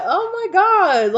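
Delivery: high, loud and slow through laughter; amused disbelief at a prank; laughing oh my God. Pretend. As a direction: laugh it out long. Exasperated laugh.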